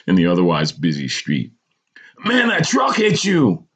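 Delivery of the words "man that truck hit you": high, slow urgent explanation; almost apologetic. A narrator's reading. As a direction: break the bad news, pitched high. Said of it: "The stranger was almost apologetic".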